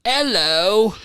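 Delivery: a quick put-on British hello; mock British accent. Joking to co-hosts about proper English, mock British accent. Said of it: "speak in a British accent".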